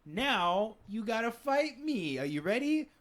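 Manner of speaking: mock-congratulation building into a challenge; challenging teacher. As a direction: play a teacher squaring up for a fight. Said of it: "talk to her like".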